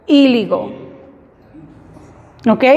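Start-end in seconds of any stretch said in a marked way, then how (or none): 0.1-0.7 'Illegal' with the opening ee stressed; emphasis on the I. Shown as a demonstration. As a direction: lean on the first vowel of Illegal, 'EE-ligol'. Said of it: "Ponemos más énfasis en la I".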